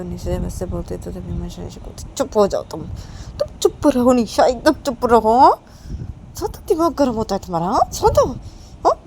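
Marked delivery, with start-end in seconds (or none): 2.1-8.9 shouting hush. Acted. Manisha to Nisha, loudly ordering her to shut up. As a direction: yell at her friend to shut up.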